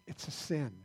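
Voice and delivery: ordinary talk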